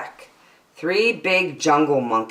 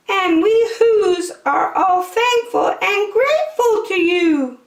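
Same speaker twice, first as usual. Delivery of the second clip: very high, squeaky little voice; tiny high mayor of Whoville; tiny speck voice; 4 dB louder than usual. Acted. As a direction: voice from a speck of dust. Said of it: "called the voice".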